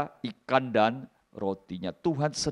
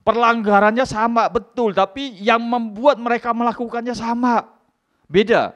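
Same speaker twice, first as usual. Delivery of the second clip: preaching at full force; 11 semitones higher, 9 dB louder than usual. Acted. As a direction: stir the congregation. Loud preaching. (khotbah keras dan bersemangat)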